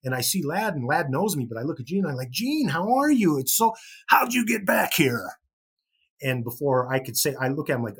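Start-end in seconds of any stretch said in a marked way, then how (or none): 2.3-5.2 higher, louder, slow eager fan; starstruck greeting. Retold or imitated. Fan meeting his hero, higher, louder excited.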